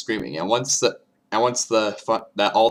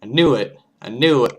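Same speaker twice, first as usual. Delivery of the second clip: excited; 5 semitones higher, 7 dB louder than usual. Real, not acted. Excited reaction after reading.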